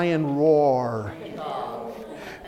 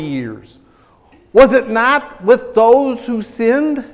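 slow, raised, emphatic Bible reading; scripture reading; 5 semitones higher, 4 dB louder, slower than usual. Real, not acted. Preacher reading Hebrews to the church, emphatic scripture reading. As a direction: declaim each phrase slowly and deliberately.

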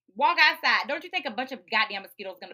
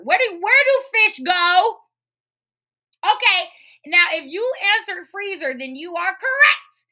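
exasperated quizmaster; 9 semitones higher, 10 dB louder than usual. Real, not acted. Host quizzing listeners on storing fish, exasperated shouting. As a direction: shout it like a quiz nobody should fail. Loud mock quiz for the listeners.

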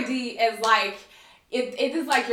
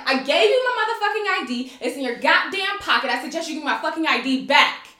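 losing it; 3 semitones higher, 4 dB louder than usual. Retold or imitated. Woman demands her ID from officer in car, losing it. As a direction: curse him out, punching the swear words. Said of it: "So then I just lose my shit".

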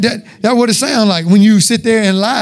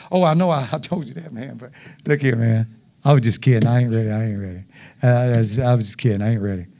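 lower, softer mock excuse of a caught liar; a sheepish liar backpedalling, voiced lower and softer; lying excuse; 8 semitones lower, 7 dB quieter than usual. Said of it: "When you lie, that's what it sounds like".

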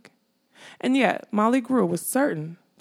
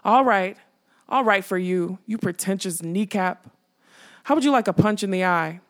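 plain reading voice

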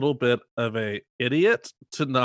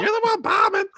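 sassy drag queen voice; 5 dB louder than usual. Acted. Echoing drag voice.

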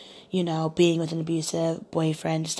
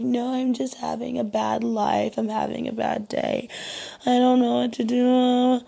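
mock crying moan; 6 semitones higher, 3 dB louder than usual. Woman mimicking her date having a bad day, mock moaning. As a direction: wail it in a mock tearful voice. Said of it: "he was crying and he was moaning".

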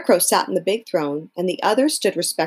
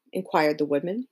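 ordinary delivery, nothing marked

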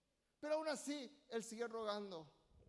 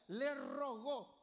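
le rogó drawn out like a gentle request; tender plea; much slower than usual. Shown as a demonstration. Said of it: "pidiendo con ternura".

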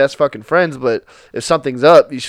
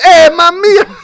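high, loud, hammed-up "mamma mia" call; playful flirty shout; mock Italian flirt; 10 dB louder, slower than usual. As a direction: belt it like a cheesy Italian charmer. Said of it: "and says".

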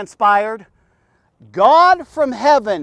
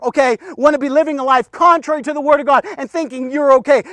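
ordinary talk

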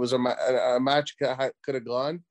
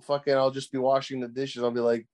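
usual speaking voice

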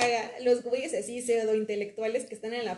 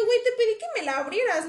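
higher, louder indignant retort; higher, louder mock outrage at the guy; retold angry complaint; 8 semitones higher, 4 dB louder than usual. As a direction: snap back at him, offended. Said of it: "Y obviamente me emputé".

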